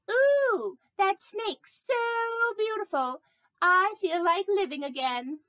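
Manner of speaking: very high, drawn-out ooh of wonder; awestruck admiration. Pretend. Snake retells people admiring it, high slow gush. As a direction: gush over the snake slowly. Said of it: "close enough".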